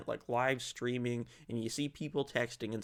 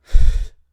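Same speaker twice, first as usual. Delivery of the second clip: loud admiring sigh; 24 dB louder than usual. Real, not acted. Swooning over Courtney Cox.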